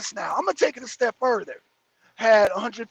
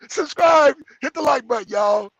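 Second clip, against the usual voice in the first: loud call to viewers; 3 semitones higher, 5 dB louder than usual. Real, not acted. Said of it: "calling out to viewers".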